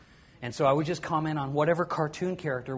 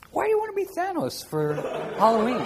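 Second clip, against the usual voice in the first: high, loud, laughing disbelief; amused high-pitched why; laughing question; 9 semitones higher, 7 dB louder than usual. Real, not acted. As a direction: ask through a laugh.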